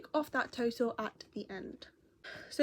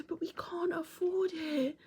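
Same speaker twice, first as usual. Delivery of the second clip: put-on slow, higher, whispered child's sigh; whispered resignation; 7 semitones higher, 5 dB quieter, slower than usual. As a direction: whisper it like a disappointed boy. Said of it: "he was going".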